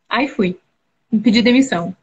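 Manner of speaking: normal talking voice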